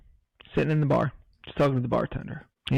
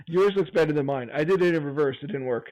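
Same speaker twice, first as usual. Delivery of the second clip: ordinary talk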